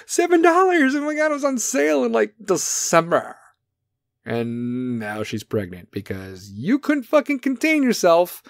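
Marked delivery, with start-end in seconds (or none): 0.0-3.3 put-on high excited gushing girl voice; hammed-up squeal about a sale; mock girly gush. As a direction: gush about a bargain dress. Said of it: "Be like".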